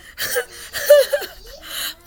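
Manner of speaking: high squealing laugh; high laughing fit. Real, not acted. Laughs hard.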